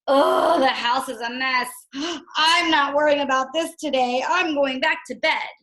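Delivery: lazy, can't-be-bothered sigh; lazy brush-off. Pretend. Teacher voicing a lazy Toad, mildly dismissive. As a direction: shrug the mess off and go back to bed. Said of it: "Or did he say".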